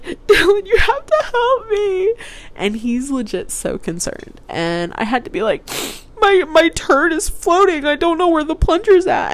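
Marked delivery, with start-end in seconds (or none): 0.0-2.1 high, loud pleading, a bit slow; begging for help in a retold panic; desperate plea. 6.2-9.3 hysterical. Retold or imitated. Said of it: "like, hysterical".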